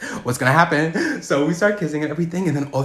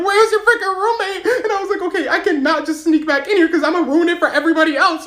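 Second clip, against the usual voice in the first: high, laughing voice; giggling through it; laughing retelling; 5 dB louder than usual. Retold or imitated. Sergeant in his room, told high with laughter.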